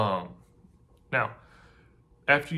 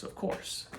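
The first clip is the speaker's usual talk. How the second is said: whispered vendor; 9 dB quieter than usual. Retold or imitated. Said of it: "And say".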